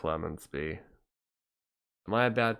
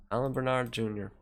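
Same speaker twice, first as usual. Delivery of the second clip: ordinary delivery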